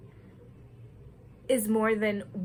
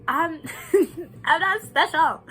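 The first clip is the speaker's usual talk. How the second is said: laughing; 7 semitones higher, 6 dB louder than usual. Real, not acted. Laughing at own praise.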